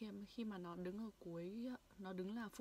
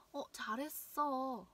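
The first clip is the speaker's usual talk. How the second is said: cheerful praise; 4 semitones higher, 6 dB louder than usual. Shown as a demonstration. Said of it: "Hãy cùng luyện tập lại nha".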